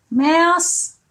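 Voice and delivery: ordinary delivery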